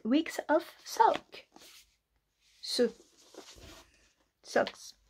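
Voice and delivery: slow exaggerated English accent on "Weeks of Sock"; mock posh English pronunciation. Reading the book title with a put-on English accent. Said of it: "Quel accent merveilleux".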